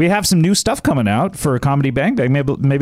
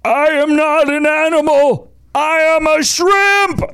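anguished cry; 13 semitones higher, slower than usual. Acted. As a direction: cry it out desperately, drawn out. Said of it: "There's our sixth quote".

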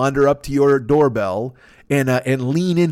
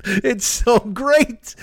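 real high laugh breaking through the words; 12 semitones higher, much slower than usual. Real, not acted. Telling a favorite Letterman bit, laughing hard. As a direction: crack up while you say it.

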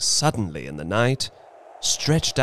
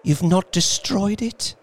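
anxious; 11 semitones higher, 3 dB louder than usual. Acted. Wife urgently asking about paw.

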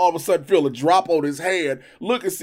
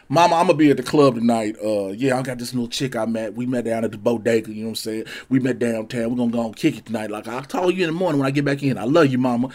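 put-on lower, fast easygoing young guy on the phone; voicing a young man; 10 semitones lower, faster than usual. Young clubber tells his mama he'll call in the morning, low and quick. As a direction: rattle it off casually to mom.

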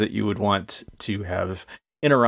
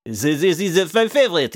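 stumbling into a mock French accent, higher pitched; accidental French accent; 8 semitones higher, 4 dB louder than usual. Thrown off by the accent game, a hesitant higher mock French accent. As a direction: slip into a hammy French accent by mistake. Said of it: "now I'm doing French now".

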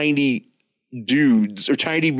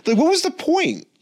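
incredulous. Real, not acted. Incredulous rhetorical question.

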